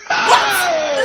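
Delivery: very high, very loud outburst; shocked shout. Retold or imitated. Telling viewers of the seafood reveal, shocked shout. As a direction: shriek it in exaggerated disbelief.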